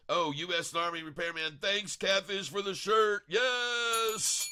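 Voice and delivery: high, singsong shout-out rising to a long yes; excited thanks. Real, not acted. Streamer thanking Catfish for the shirt, excited high call.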